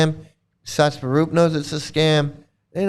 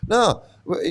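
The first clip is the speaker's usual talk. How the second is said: normal talking voice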